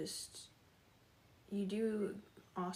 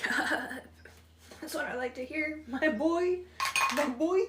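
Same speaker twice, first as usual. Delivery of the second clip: higher and louder proud cheer; excited cheer; 6 semitones higher, 7 dB louder than usual. Real, not acted. Streamer cheers viewer Kaden's good news, loud and proud.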